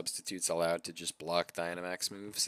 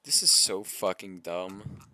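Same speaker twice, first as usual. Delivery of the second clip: slow, louder fed-up rant; frustrated; 7 dB louder, slower than usual. Real, not acted. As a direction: spit it out slowly, fed up. Frustrated at game mechanics.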